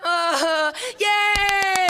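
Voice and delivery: very high laugh into a yay shout; hammed-up newcomer cheering; mock cheer. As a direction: squeal a giddy yay.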